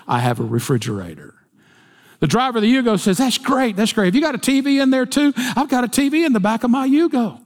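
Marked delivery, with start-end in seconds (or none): 0.0-1.4 lower, softer, clipped annoyance; a touch of irritated snob; annoyed rich driver. Acted. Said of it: "The driver of the Rolls looks annoyed". 3.2-7.4 higher, eager bragging; pitched-up smug little boaster; cocky Yugo driver. Acted.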